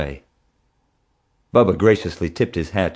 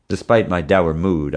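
usual speaking voice

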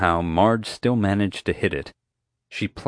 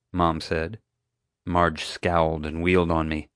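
plain reading voice

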